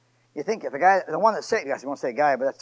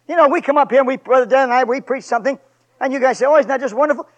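louder preaching push; 7 semitones higher, 9 dB louder than usual. Acted. Preacher scolding his listeners, louder. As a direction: lift it to the congregation.

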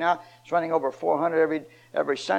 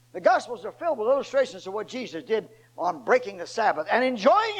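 full-force preaching; 8 semitones higher than usual. Acted. Preacher to his congregation, at full force.

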